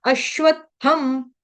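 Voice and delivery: slow अश्वत्थम with half त then full थ; careful ashvattham. Shown as a demonstration. Teacher correcting students' अश्वत्थम. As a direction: say अश्वत्थम syllable by syllable. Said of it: "थम नहीं बोलेंगे".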